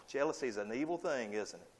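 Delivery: usual speaking voice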